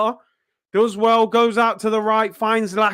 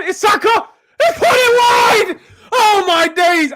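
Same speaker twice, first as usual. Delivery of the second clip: disbelieving cry; 10 semitones higher, 3 dB louder than usual. Real, not acted. Fan crying out as Saka puts it wide, real anguish. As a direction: cry out as the chance goes wide. Near chance missed, fan reacts.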